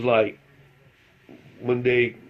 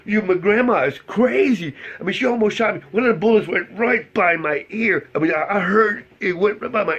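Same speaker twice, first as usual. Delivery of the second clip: put-on high, excited kid voice, much higher; panicked kid; 8 semitones higher, 4 dB louder than usual. Cousin telling the narrator, a high frightened kid voice. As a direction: blurt it out like a scared kid who almost got shot.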